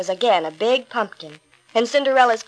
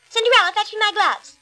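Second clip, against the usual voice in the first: high, louder bossy demand; bossy stepsister; 10 semitones higher, 3 dB louder than usual. A narrator's reading. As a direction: snap the order again, high. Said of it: "looking at themselves in the mirror".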